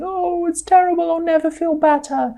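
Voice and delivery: high-pitched voice of a woeful heart; hammed-up wail, pitched well up; mock despair. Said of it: "your heart might be saying".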